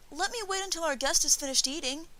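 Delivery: put-on high, pleading child's voice; begging boy. A narrator's reading. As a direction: beg to stay up like a kid. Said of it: "begged Yoshiteru".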